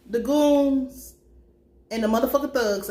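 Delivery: normal talking voice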